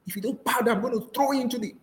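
high-pitched, hammed-up royal outrage; angry king. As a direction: play a king issuing an angry ultimatum. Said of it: "The king was angry".

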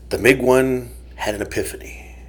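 soft dramatic whisper. Pretend. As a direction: whisper it like a big secret. Dramatic history reveal.

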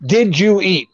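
ordinary delivery

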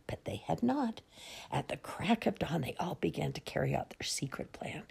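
hushed, whispered storytelling; soft whispered reveal of a surprise; secretive whisper. A narrator's reading. Children's secret birthday plan.

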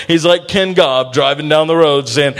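usual speaking voice

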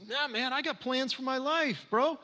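high-pitched mock bro voice; clueless dude quote. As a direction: send up a guy bragging about his YouTube plans. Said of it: "he goes".

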